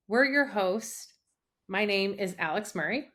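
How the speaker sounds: ordinary talk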